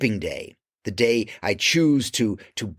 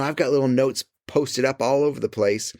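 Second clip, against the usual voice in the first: ordinary delivery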